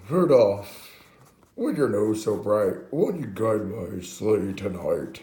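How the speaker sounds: loud, slow, grand put-on plea; Santa. Santa asks Rudolph to guide his sleigh, loud slow grand voice. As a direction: draw it out big and theatrical. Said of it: "then he's like".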